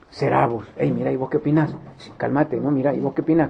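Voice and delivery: low, hushed chatter among friends; whisper-talk as if unsure it's really him; disbelieving murmur. Acted. Disciples murmuring to each other in hushed disbelief.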